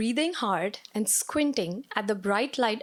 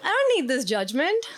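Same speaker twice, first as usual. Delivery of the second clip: mock offended; 8 semitones higher than usual. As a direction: play it hurt but amused. Offended reply to accent jab.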